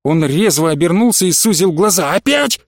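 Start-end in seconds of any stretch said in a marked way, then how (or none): 2.1-2.6 sharp high rising question; high-pitched indignant echo; outraged disbelief. A narrator's reading. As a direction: leap up in pitch, incredulous. Professor reacts with outrage.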